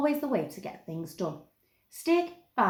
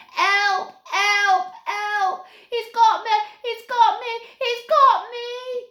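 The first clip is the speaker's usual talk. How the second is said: very high, loud repeated cries for help, last one drawn out; terrified screaming; 8 dB louder, slower than usual. A narrator's reading. Fallen youngest Snaggart cries out as Stig stands over him, terrified screams. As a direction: scream for help as if caught by a monster. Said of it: "shrill scream of terror".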